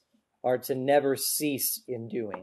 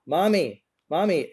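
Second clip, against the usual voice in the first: mock child whining for Mommy, higher pitched; a kid tugging at Mom, pitched up; imitating a child calling; 4 semitones higher, 4 dB louder than usual. Preacher imitates child calling parent.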